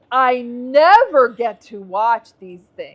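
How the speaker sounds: loud, slow, drawn-out whine; exaggerated grievance, leaning hard on "never"; mimicked complaint. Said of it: "She says".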